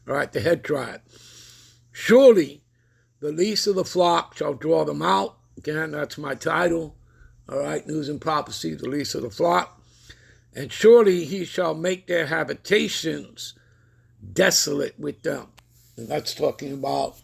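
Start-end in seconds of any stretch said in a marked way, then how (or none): 1.9-5.4 loud, high proclaiming of the verse; scripture reading. Acted. Reads scripture aloud. 10.5-15.5 scripture reading. Acted. Reads scripture aloud.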